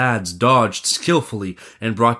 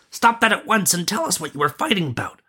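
high, commanding call at moderate volume; crying out; 9 semitones higher, faster than usual. Acted. Prince breaking up a fight, high call. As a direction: order them to stop, voice pitched up. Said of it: "cried the prince".

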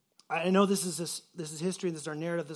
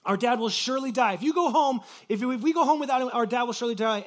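higher and louder, urgent plea; desperate pleading; 5 semitones higher, 7 dB louder than usual. Retold or imitated. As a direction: raise the voice, begging in desperation. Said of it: "of pleading".

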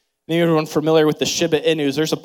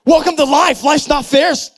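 higher, louder mock-blunt dad; 10 semitones higher, 6 dB louder than usual. Dad bluntly telling his crying kids the dog will die, mock harsh. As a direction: toss it off with careless bluntness. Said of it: "You don't say that".

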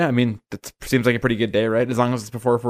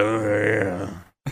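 put-on character voice; 3 dB quieter than usual. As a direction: do an exaggerated rock-singer voice. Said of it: "that voice that you were just doing".

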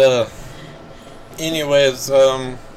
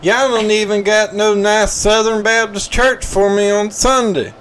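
hammed-up Southern accent; 9 semitones higher, 6 dB louder than usual. NASCAR fan lost at a golf tournament, loud high Southern accent. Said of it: "Southern [name]'s done for the day".

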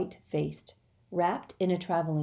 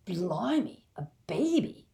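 dazed surprise; 2 semitones higher than usual. A narrator's reading. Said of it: "as if he had never heard of such a thing".

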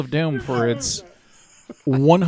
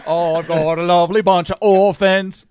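louder, hammed-up British showman; bad British accent; 2 semitones higher, 5 dB louder than usual. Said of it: "our accents are so bad".

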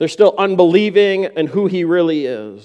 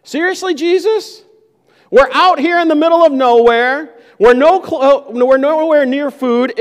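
much higher, incredulous exasperation; exasperated disciples; 6 semitones higher than usual. Acted. Disciples protesting Jesus' order to feed the crowd, exasperated. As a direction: throw up your hands in disbelief. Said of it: "they're astonished and exasperated by Jesus".

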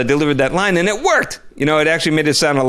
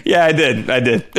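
normal talking voice

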